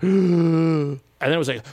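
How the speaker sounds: huge inhale then a long blow; big gasp. Retold or imitated. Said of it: "I took this huge in, in breath".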